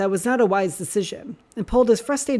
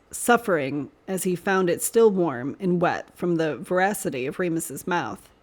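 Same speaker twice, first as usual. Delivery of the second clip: ordinary delivery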